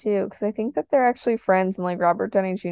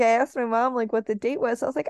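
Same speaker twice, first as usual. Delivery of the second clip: ordinary talk, nothing marked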